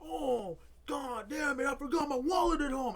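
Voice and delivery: loud, high imitation of angry shouting; retold outburst. Retelling brother's outburst at laundry, mock angry shout. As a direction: yell it as the exasperated brother. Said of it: "My brother had an outburst".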